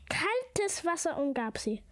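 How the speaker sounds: ordinary delivery, nothing marked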